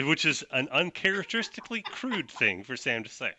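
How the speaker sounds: ordinary talk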